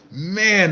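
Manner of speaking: bursts out laughing at the name; a high, breathy burst of real laughter; amused laugh cracking into speech. Real, not acted. Reads a silly viewer name.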